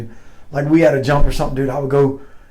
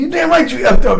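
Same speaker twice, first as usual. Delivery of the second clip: high, loud mock chewing-out; imitated going off; 9 semitones higher, 5 dB louder than usual. Sergeant major confirming the soldier messed up, mock-angry. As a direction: lay into him as the retold sergeant major. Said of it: "that guy went off".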